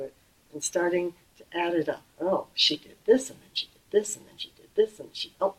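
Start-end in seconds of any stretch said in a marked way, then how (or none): 2.2-5.5 lightly voiced petty tallying of wrongs; mock grudge-keeper. Teacher imitating someone counting up grudges, mild mock pettiness. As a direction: tick off each grievance like a scorekeeper. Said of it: "you know, keeping score".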